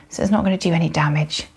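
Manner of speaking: normal talking voice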